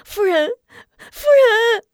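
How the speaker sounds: high loud crying call. Acted. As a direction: wail her name in tears. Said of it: "二人一遍一遍哭喊着". (哭喊着“夫人”)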